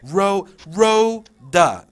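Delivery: "Rhoda" loud and slow, split Rho... da; two separated syllables. Shown as a demonstration. Trainer showing how "Rhoda" splits, two slow syllables. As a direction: punch out "Rhoda" one syllable at a time. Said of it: "you know, two syllables".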